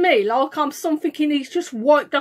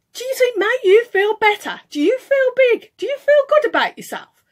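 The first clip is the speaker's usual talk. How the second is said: high, loud, insistent questions; retold angry challenge; 7 semitones higher, 5 dB louder than usual. Asking the other driver if he feels big, indignant. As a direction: fire indignant questions, voice raised. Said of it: "I was quite angry".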